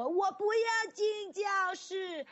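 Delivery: very high, slow, drawn-out whine; whiny child. Retold or imitated. Child at kindergarten refusing class, slow high whine. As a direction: drag it out like a kid at the door.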